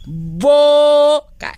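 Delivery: Boca said high and loud; emphatic repeat. Shown as a demonstration. Teacher repeating word to pupils, loud and high. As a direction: stress Boca for the class. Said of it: "Con B".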